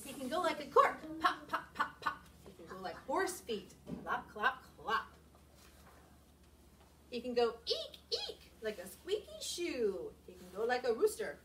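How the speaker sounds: lively picture-book reading with big sound words; expressive read-aloud. Acted. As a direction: read it to the kids with full expression.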